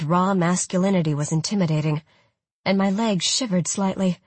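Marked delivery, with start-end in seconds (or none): none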